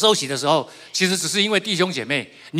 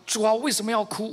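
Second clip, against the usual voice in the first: retold inner cry; 7 semitones higher than usual. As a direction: plead upward as if crying out silently. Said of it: "我在心里面一直呼喊".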